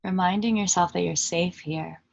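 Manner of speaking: calm meditation guide with long pauses between phrases; unhurried, even voice settling listeners into stillness; guided meditation. Acted. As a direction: lead them gently inward, leaving long silences.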